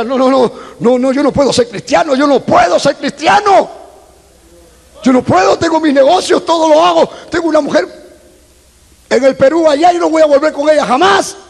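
high-pitched, agitated refusal; protesta desesperada. Retold or imitated. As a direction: protest insistently, voice climbing high. Retold inner protest in the office. (protesta agitada, en tono agudo)

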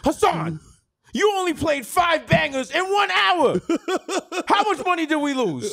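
high, loud outraged yelling; angry shouting. Pretend. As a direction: bellow at the DJ. Impersonating Taffer yelling.

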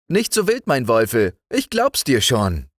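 put-on high, bright child voice; cheerful young girl talking, pitched well up; little girl Liesel. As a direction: speak up like a fearless little girl. Liesel pets the dog.

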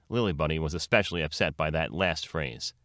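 ordinary delivery

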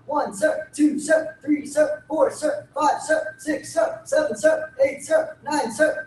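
quick, high rhythmic count-off; fast counting. Real, not acted. Instructor counting jumping jacks for the class, fast and high. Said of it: "counting fast".

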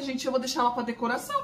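usual speaking voice

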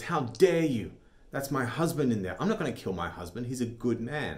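a wife appalled at the order; outraged wife. Acted.

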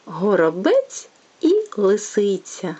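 plain reading voice

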